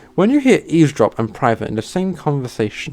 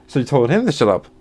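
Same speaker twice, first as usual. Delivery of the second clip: usual speaking voice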